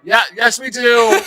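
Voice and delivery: high mock cheer from an audience; imitated crowd reply. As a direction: chime in like an eager crowd. Acts out audience shouting back.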